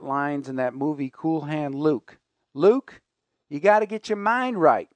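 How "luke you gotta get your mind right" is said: put-on a so-so Texas warden accent with a raised voice. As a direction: play the prison warden with a hint of a Texas accent. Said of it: "my Texas accent is not very good".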